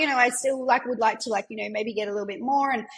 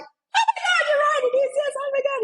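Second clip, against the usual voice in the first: high, loud excited squeal of a thrilled client; 4 dB louder than usual. Retold or imitated. Client on Zoom, thrilled about her record week, squealing excitedly. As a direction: squeal it like you just got the best news. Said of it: "And she's so excited".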